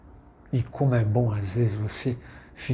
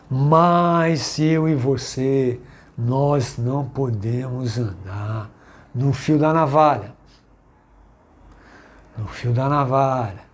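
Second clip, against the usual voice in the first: preaching at full force; 2 semitones higher, 4 dB louder than usual. Acted. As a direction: thunder the warning from the pulpit. Preaching warning to congregation.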